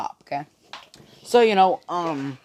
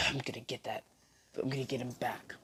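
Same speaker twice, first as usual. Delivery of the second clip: muttered vow; 2 semitones lower, 17 dB quieter than usual. Retold or imitated. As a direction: mutter it under your breath, plotting payback. Defeated rival vows revenge to himself.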